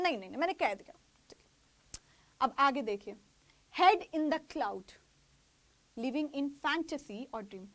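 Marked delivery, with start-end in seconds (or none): 3.8-7.7 each word drawn out very slowly for the class; slow dictation. Shown as a demonstration. Teacher dictating an idiom to her class, very slow and even. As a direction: spell it out slowly so students can copy it.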